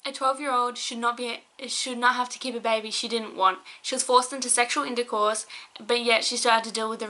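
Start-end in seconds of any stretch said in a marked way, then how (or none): none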